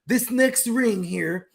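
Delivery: slow, high salesy TV pitch; shopping channel host. Retold or imitated. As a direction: hype the deal like a QVC host. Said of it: "when they be like".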